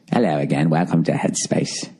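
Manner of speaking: mock the app narrator's calm British accent; soothing British accent. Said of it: "soothing voice like this, some sort of British accent".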